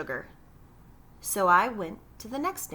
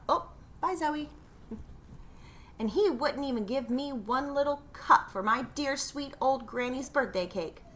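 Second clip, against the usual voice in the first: ordinary delivery, nothing marked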